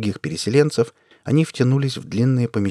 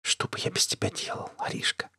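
tender unvoiced whisper to his wife; hushed, breath-only endearment; 9 dB quieter than usual. A narrator's reading. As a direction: whisper it close, very softly. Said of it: "и зашептал".

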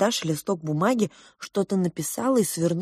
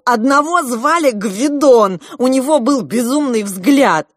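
loud, higher, emphatic outburst; excited shouting; 7 semitones higher, 8 dB louder than usual. A narrator's reading. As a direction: keep shouting out what you remember. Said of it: "закричал Клоп". (взволнованно крича)

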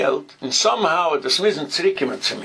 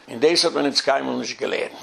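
normal talking voice

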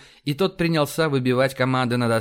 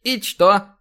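higher, louder puzzled question; serious surprise; 7 semitones higher, 6 dB louder than usual. A narrator's reading. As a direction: ask it in all seriousness, taken aback. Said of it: "На полном серьёзе удивился Рабинович". (искреннее удивление)

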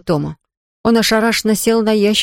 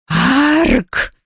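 high squeaky hiccup; ghost hiccup; 6 semitones higher than usual. Acted. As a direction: hiccup it out sharply. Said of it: "икнуло привидение".

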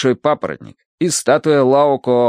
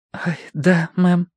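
high-pitched, meek assent; abashed; 7 semitones higher, slower than usual. A narrator's reading. Niece rebuked by her aunt, abashed. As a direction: back down meekly. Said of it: "стушевалась Верити".

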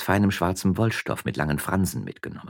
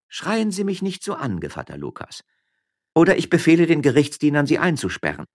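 high, commanding retort; imperious threat; 11 semitones higher, 5 dB louder than usual. Acted. Wife threatening to jail her husband, imperious. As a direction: order him silenced.

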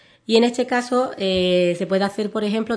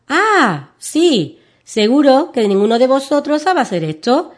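put-on higher, louder triumphant little girl; proud excitement; 6 semitones higher, 7 dB louder, slower than usual. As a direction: boast like a delighted child. Carlota proudly shows her trick. (entusiasmo orgulloso de niña)

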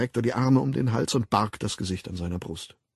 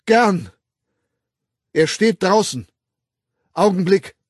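eager triumph; 9 semitones higher, 9 dB louder than usual. Acted. Bernard triumphantly fetches the witness.

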